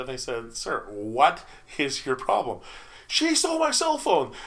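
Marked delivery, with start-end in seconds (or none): 0.6-2.6 slow, deliberate challenge; measured, pointed confrontation of a rude customer; confrontational. Retold or imitated. Chef getting in drunk patron's face, confrontational. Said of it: "got in his face a little bit". 3.1-4.3 high, loud mock-indignant customer; indignant outburst. Drunk patron accusing server to chef, indignant.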